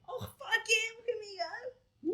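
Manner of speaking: real high, loud whoop of triumph; excited cheer. Real, not acted. Client celebrates eighteen tattoos, excited high cheer.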